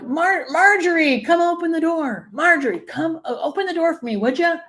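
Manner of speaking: mock calling out. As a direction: call out to the dead sister, imitated. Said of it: "she would yell for".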